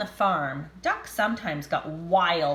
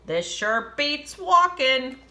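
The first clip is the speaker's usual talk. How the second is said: pig voice; 5 semitones higher, 5 dB louder, slower than usual. Acted. Pigs' thought voiced.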